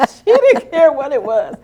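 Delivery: loud, high, amused exclamation; punchline thrown out through laughter; laughing. Real, not acted. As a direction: crack up while telling it. Laughs over the nurse's free trip.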